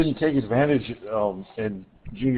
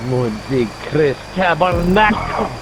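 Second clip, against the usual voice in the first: slow, drawn-out Quebecois French swear; 3 semitones higher, 4 dB louder, much slower than usual. Pretend. Showing off Quebec French swears to friends, slow and drawn out. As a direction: savour each Quebec French curse slowly. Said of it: "That's some good French swears".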